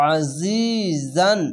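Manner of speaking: আজিজান slow, the জ buzzing; buzzing jha. Shown as a demonstration. Teacher showing the buzzing জ sound in আজিজান. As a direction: let the জ of আজিজান buzz. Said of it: "দেখেন".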